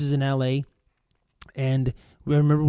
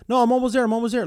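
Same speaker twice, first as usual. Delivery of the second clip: stalling friend; 8 semitones higher, much faster than usual. Acted. Acted reply, friend stalling.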